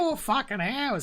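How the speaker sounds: high, slow, drawn-out broad Australian complaint; mock broad Australian. Podcaster mocking a long film in a broad Australian voice. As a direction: stretch it like a broad Australian bloke. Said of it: "Here, Zorro".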